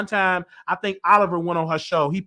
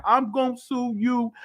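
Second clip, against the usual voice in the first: higher mocking whine of a celebrity; 7 semitones higher than usual. Commentator mocks [name]'s tearful threat, whiny. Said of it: "[name] got up there crying".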